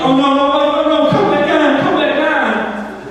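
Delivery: high, whiny mock panic; comic high-pitched begging; mock pleading. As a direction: beg the heater, stretching the last words. Pleading with heater that went off.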